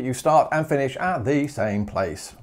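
usual speaking voice

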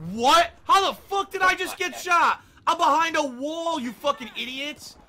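high, loud indignant shouting; real exasperated rant; furious disbelief. Real, not acted. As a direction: yell in outrage at the game. Outraged at being shot.

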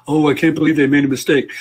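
a lightly put-on voice of a tutting listener; mock judgmental listener. Teacher imitating a critical audience member, lightly mocking. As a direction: sneer at the slip, half-jokingly. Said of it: "that's how I always talk".

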